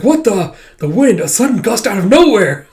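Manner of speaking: startled outburst; shocked. Retold or imitated. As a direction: sound thrown by a surprise. Shocked by sudden gust.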